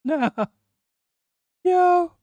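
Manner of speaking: a sudden very high "Yo" squeal; excited yelp. Real, not acted. Streamer shocked by the accent clip, high squealed Yo. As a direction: yelp it out in high-pitched disbelief.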